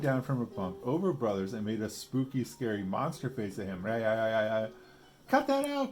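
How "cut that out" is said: high loud protest; startled outcry. A narrator's reading. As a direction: cry out when startled. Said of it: "cried Brother".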